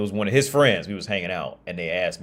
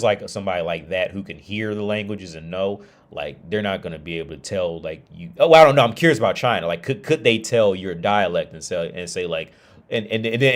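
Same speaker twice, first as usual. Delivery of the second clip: ordinary talk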